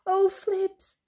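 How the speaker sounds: high-pitched dismay; mild panicky squeal; alarmed cry. Acted. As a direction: cry out as if about to be caught. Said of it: "cried Alby".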